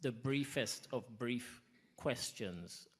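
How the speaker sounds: briefest and brief drawn out slowly, each one leaned on; slow down and hammer the word brief; the briefest of brief, spelled out word by word; stress on brief. Shown as a demonstration. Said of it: "I don't know how to emphasize the word brief".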